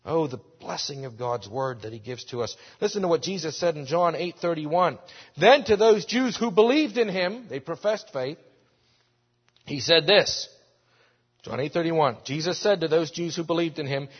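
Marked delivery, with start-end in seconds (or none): none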